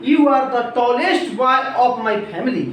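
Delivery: very slow, careful example sentence; slow teacher's example. Shown as a demonstration. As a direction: dictate it slowly. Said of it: "और अगर मैं कह दूं".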